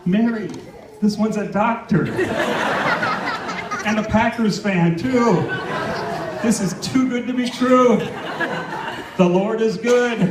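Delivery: hammed-up thrilled dad, laughing; excited. Excited retold call, rising pitch.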